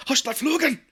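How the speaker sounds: high, loud mock German accent; high, loud imitation of a German accent. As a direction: bark it like a cartoon German. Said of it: "Conan O'Brien does his German impression".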